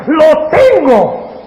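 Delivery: long drawn-out mock triumph; gloating Satan. Satan sure he has God trapped, in drawn-out gloating. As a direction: stretch it slowly, savouring the catch.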